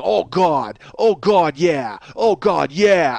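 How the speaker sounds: heavy New York moaning; New York accent. Retold or imitated. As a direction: moan it like a loud New Yorker. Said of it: "he has this really heavy New York accent".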